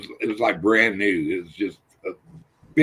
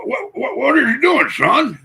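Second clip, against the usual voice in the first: much higher, squeaky imitation of a big man; dad's voice. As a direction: mimic how dad talked to his son. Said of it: "that's how he talked".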